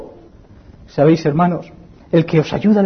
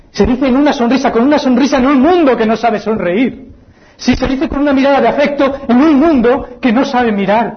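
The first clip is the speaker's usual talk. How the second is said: louder and higher, building fervor; fervent preaching; 8 semitones higher, 4 dB louder than usual. Acted. Preacher to congregation, raised fervent preaching. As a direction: proclaim it to the hall. Preacher repeats phrase with force.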